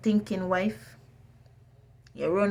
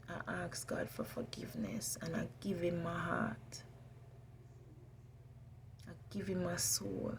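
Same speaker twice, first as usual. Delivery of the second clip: whispering; 15 dB quieter than usual. Real, not acted. Whispered prayer-like confession.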